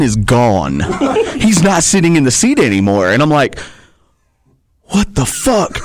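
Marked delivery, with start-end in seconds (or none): 4.9-5.8 retold startled yell. Retold shock, Talon vanished.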